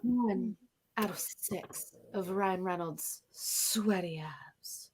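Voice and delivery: soft breathy whisper, trailing off. Shown as a demonstration. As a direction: breathe the words out seductively. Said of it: "can you say it again with a breathy voice".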